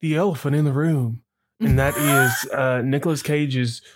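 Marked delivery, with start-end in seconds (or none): 0.0-2.5 mock Southern accent. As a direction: lay on a put-on Southern accent. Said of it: "what I'm doing is too, is better than what Nicolas Cage did".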